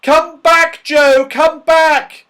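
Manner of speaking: loud high desperate call; yelled. A narrator's reading. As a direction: scream after him. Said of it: "yelled Beth and Fran".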